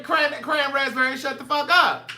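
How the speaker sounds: mock [name] order; [name] impression. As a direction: hit it like the movie line. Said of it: "That's what [name] said".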